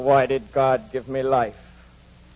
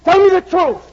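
shouted, much higher and louder; furious demand; 12 dB louder than usual. Acted. Heathcliff demanding the truth from the housekeeper, shouting. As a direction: bark the demand at her like a threat.

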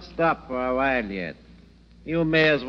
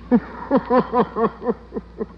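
mocking laugh; 9 semitones higher than usual. Stranger laughs at lost tourist.

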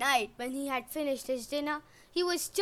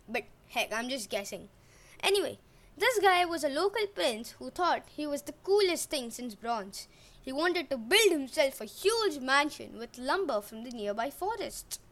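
ordinary delivery

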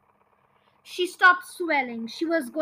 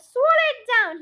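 high, raised voice; cried out; 7 semitones higher, 6 dB louder than usual. A narrator's reading. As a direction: urge it loudly. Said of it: "George cried".

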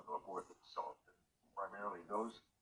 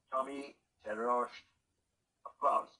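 drawn-out, louder host announcing a name; big guest announcement; 4 semitones higher, 10 dB louder, slower than usual. Acted. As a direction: roll the name out like a talk show host. Host announcing his special guest.

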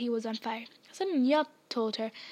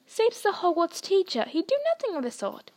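higher insistent Hagrid; Hagrid insisting; 7 semitones higher than usual. Acted. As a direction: brush off the accusation. Hagrid defending Snape.

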